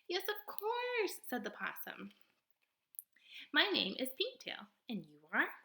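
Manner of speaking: high, lively possum voice, theatrical; a showy little stage actor of a possum; possum character voice. Acted. Possum boasting to the robot, high and theatrical.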